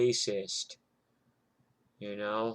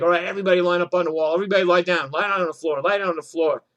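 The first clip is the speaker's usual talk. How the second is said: fast, loud shouted commands; cop barking orders; 6 semitones higher, 8 dB louder, much faster than usual. Retold or imitated. Cops bursting in order everyone onto the floor, loud fast shouting. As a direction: yell it like a cop storming the room.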